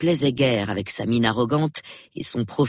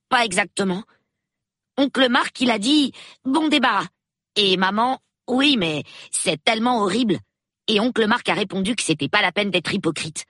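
put-on boy's voice pitched higher, eager and quick to talk; a nine-year-old thrilled to meet the police; excited little boy; 9 semitones higher than usual. A narrator's reading. Peter reports his uncle and mother. (petit garçon tout excité, voix plus aiguë)

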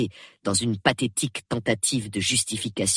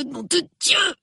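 "nom de Dieu" cried out much higher; Basil, head in hands, cries "nom de Dieu" up high; rising despair. A narrator's reading. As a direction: let "nom de Dieu" break out in anguish. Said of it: "répéta-t-il".

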